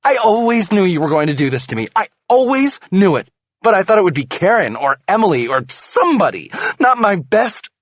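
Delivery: high, loud, fast bitter tirade. A narrator's reading. Wife lashes out at husband over betrayal, bitter. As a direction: lash out in betrayal.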